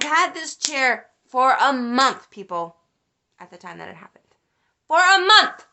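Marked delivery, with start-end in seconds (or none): 4.9-5.7 loud, high exclamation of outrage; angry shout. Real, not acted. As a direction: yell it, outraged at the long wait. Mad again about the month's wait.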